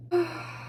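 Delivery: soft breathy sigh; whispered sigh. Real, not acted. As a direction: sigh it out quietly. Anxious vlogger sighs.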